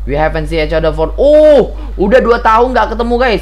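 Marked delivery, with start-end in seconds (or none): 1.2-3.4 high, loud excited outburst; loud amazed exclamation; exclaiming. Real, not acted. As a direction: burst out in surprise. Exclaiming at dialogue.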